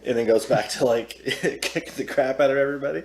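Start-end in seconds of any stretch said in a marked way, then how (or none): none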